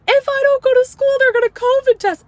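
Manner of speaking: put-on high, loud whiny child retort; bratty kid voice. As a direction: squeak out a last excuse. Daughter's retort.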